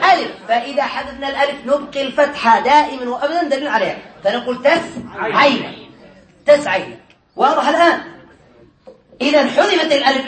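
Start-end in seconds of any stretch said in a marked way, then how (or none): 4.6-5.9 تس عين slow, with a gap after تس; teacher assembling تس عين slowly in two parts; built piece by piece. Shown as a demonstration. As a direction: hold تس, pause, then add عين. Teacher builds word piece by piece.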